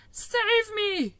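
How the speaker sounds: high panicked scream. A narrator's reading. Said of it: "screamed the flesh lump eater".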